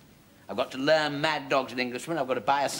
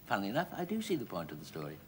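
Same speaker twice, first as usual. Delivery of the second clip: softer, fast, resigned mutter; quiet weary muttering; 6 semitones lower, 10 dB quieter, faster than usual. Acted. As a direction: say it to yourself, worn out. Son fed up with repeated story.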